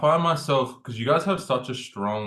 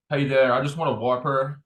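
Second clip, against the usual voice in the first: put-on American accent; 3 semitones higher, 3 dB louder than usual. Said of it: "I'm gonna pretend I'm going through the drive-through".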